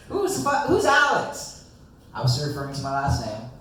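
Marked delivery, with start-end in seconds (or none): 0.1-1.6 high, slow, baffled shout; high confused outburst. Retold or imitated. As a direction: squeak it out in drunk confusion. Drunk confused retort quoted.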